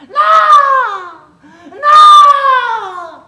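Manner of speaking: shrieked no, very high and very loud; a wailing scream of no; crying out. Acted. The fox struck on the forehead, wailing scream. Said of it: "il s'écria".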